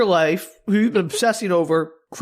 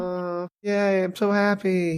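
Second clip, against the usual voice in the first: softer, dry fake cheer; sarcastic yay; 4 dB quieter, much slower than usual. Real, not acted. Host mocking the happy ending, soft deadpan. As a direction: say it like you couldn't care less. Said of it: "sarcastic yay".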